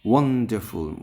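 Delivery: Wonderful with a loud one; stress on first syllable. Shown as a demonstration. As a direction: punch one, let the rest fall away. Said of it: "ওয়ানের পরে আমরা stress দেব".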